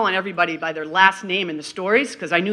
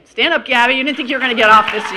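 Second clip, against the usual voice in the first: calling out; 5 semitones higher, 10 dB louder, faster than usual. Real, not acted. Calling a graduate to stand.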